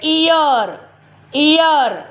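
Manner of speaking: ईयर said slowly as one long ee sound; ईयर with the a silent, clear and drawn out; modelled word. Shown as a demonstration. Teacher models ईयर for the children, slow and clear. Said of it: "हम क्या बोल रहे हैं".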